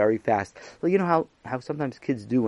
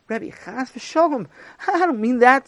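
near tears; 11 semitones higher, 4 dB louder than usual. Acted. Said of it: "ready to burst into tears".

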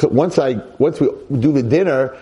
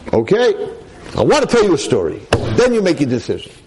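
rabbi voiced; 5 semitones higher than usual. Rav Simcha insists on story.

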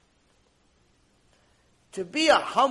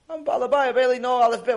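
raised, emphatic objection; acted protest; 7 semitones higher, 7 dB louder, faster than usual. Unlearned man to someone wanting Gemara help, loudly protesting.

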